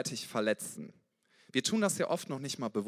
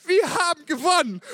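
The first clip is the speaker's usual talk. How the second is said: much higher and louder, a wild victory shout; joyful bellow; 14 semitones higher, 11 dB louder than usual. Retold or imitated. Football fan hugging a stranger at night, ecstatic yelling. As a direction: yell it like a delirious football fan. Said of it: "brüllt mich an".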